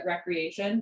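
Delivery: RECREATION with a short "reck" at the start. Shown as a demonstration. Yoga teacher naming yoga a pastime, short-e RECREATION. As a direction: say recreation like the leisure word, rek-ree-AY-shun. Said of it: "think of it also as recreation".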